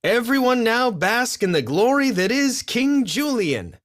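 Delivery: hammed-up royal herald; grand proclamation. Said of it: "he called out".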